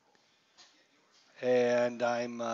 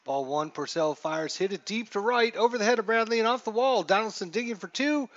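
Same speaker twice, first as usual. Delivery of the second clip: excited play-by-play; 7 semitones higher, 7 dB louder than usual. Acted. Announcer calling a double off the wall, excited and loud. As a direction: call it like the ball is still flying.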